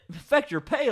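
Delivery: high-pitched disbelieving question; mock incredulity. Mimicking Mister J answering Brandon's pay worry, incredulous. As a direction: imitate a boss waving off a worry. Acting boss dismissing a worry.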